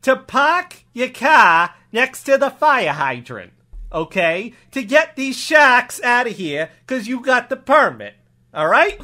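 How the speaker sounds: put-on Boston accent, dropped r's, laying it out; emphatic comic Boston cop; permissive cop. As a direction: spell it out like a local cop.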